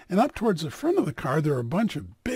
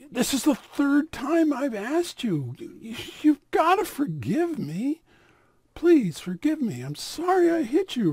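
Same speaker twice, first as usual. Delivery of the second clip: pleading; 7 semitones higher, 3 dB louder than usual. Acted. Man asks the rabbi a third time, high and pleading. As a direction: implore him, drawing it out.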